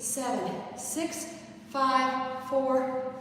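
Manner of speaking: usual speaking voice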